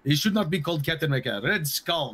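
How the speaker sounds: Russian comrade voice answering about the sock people; mock Russian accent. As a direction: ham up the broken Russian English. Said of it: "I pulled a Russian accent out".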